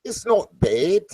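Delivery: attempting a Kiwi accent on "not bad"; man practising a Kiwi accent with his friend, trying to sound Kiwi. Shown as a demonstration. Trying for Kiwi, "bad" said the New Zealand way. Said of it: "I've gotta get the word bad right".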